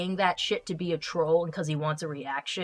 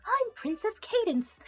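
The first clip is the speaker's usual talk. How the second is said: put-on high, slow girly princess voice; mock princess voice; Cadance voice; 12 semitones higher, slower than usual. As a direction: play a sweet cartoon princess. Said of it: "I can't do a Cadance voice, that's just a chick voice".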